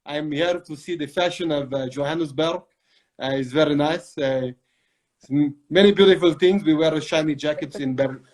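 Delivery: put-on Italian accent; playful mock-Italian character voice; Marco, Italian accent. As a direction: introduce yourself as a hammed-up Italian. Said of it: "This is more of a Marco".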